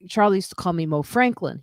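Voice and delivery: ordinary delivery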